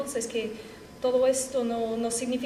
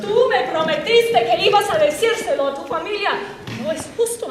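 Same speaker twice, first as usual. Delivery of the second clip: loud, raised accusation; angry accusation; 6 semitones higher, 8 dB louder than usual. Acted. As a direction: hurl the broken promise at her. Lovers' quarrel, broken promise.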